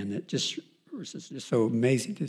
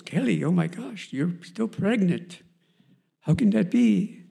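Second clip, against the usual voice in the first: a hint of an Indian doctor, higher and amazed; light Indian accent; 6 semitones higher, 5 dB louder, slower than usual. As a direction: imitate the Indian doctor lightly, stretching still pregnant. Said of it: "he's an Indian guy".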